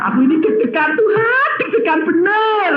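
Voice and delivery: high, half laughing, half crying; nervous laughing plea. Retold or imitated. Student before his oral exam tells God he is nervous, high and laughing. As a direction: blurt out your nerves to God with a shaky laugh. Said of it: "dia bilang".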